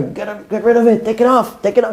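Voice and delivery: high, urgent replayed plea; mock panic. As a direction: beg the video guy in a flap. Acted panicked order to YouTube guy.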